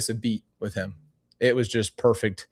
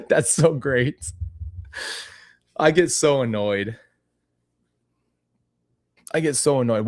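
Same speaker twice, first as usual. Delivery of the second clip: usual speaking voice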